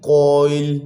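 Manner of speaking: कॉइल gliding from ओ to इल; diphthong demo. Shown as a demonstration. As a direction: glide कॉइल from ओ into इल. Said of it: "अभी देखें, पहले ओ फिर इल". (कॉइल को ओ से इल तक glide करके बोलना)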